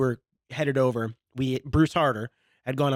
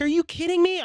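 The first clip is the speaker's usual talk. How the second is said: high, louder indignant cry; retold mad; 3 dB louder than usual. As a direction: squeal out the outrage at being set up. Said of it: "I was so mad".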